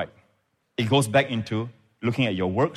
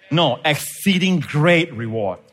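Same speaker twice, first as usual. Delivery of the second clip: slow, GREAT drawn out and punched louder; stress on GREAT; 4 semitones higher, 5 dB louder, slower than usual. Real, not acted. Preacher correcting the verse to exceeding great reward, GREAT stressed. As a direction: lean hard and long on the word great.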